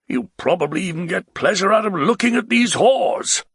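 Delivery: a tipsy nobleman begging to ride away; the Baron, pleading. Acted. Baron scolds.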